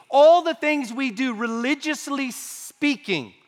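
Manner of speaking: high-pitched, emphatic sermon warning; forceful preaching. Real, not acted. Preacher warns with rising force.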